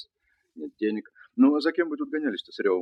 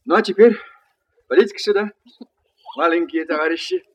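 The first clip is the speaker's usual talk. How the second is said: calling out; 5 semitones higher, 8 dB louder than usual. Acted. Calling the toy soldiers over.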